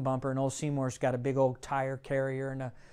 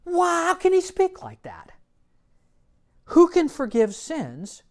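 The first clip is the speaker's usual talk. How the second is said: high, loud, scandalised gasp of a scribe; indignant scribe; 14 semitones higher, 8 dB louder than usual. Retold or imitated. Scribes scoffing at Jesus forgiving sins, high, loud outraged voice. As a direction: play the outraged scribe, much higher. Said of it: "this is what the scribes were saying".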